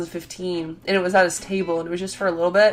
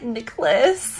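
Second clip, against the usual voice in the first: hushed swooning; 6 semitones higher than usual. Retold or imitated. Starstruck fangirl reaction retold.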